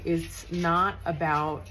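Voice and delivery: ordinary talk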